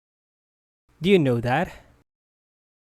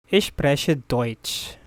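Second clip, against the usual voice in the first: Ich spreche Deutsch said slowly, each word apart; slow and clear; slower than usual. Shown as a demonstration. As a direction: spell it out slowly for the learner. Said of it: "slow repeat for learners". (slow teacher read)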